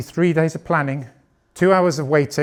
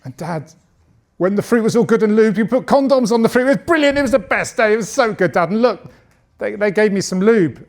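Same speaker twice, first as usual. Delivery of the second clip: lightly voiced excited kid, higher; excited child; 5 semitones higher than usual. Son telling dad about school, a hint of excited child. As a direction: gush like an eager schoolboy. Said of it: "he came home from school really excited".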